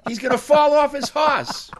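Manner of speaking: high, loud mock heckle; imitating yelling kids. Novelist imitates kids heckling the rider, mock yelling. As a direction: holler it like a jeering kid. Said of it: "with the kids yelling".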